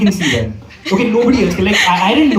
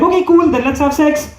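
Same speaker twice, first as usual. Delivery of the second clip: cheeky reply; 7 semitones higher than usual. Retold or imitated. His own cheeky reply, retold.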